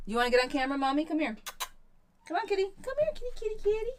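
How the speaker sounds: high sing-song kitty call; high-pitched calling to a shy cat. Real, not acted. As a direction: coax the cat over in a high pet voice. Coaxing her cat onto camera.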